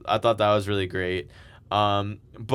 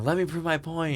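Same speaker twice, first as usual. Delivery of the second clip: mock shouting; 7 semitones higher than usual. Retelling a wrestler dragged out by security, mock shouting. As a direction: imitate a shouted protest at reduced volume. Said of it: "shouting, like".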